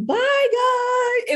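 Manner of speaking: very high, chirpy farewell; high sing-song bye; sing-song goodbye. Retold or imitated. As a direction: wave them off with glee. Cheerful farewell on leaving.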